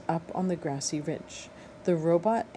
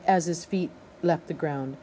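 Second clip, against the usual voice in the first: ordinary delivery, nothing marked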